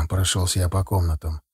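ordinary delivery, nothing marked

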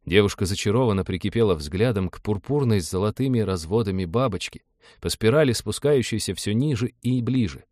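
ordinary delivery, nothing marked

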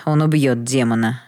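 usual speaking voice

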